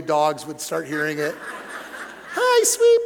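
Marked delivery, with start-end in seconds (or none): 2.3-3.0 very high, loud squeaky greeting; mom's high voice. Retold or imitated. Son imitating mom's phone greeting, very high and loud. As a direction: go up to where only dogs hear. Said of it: "She had a voice that could get really high".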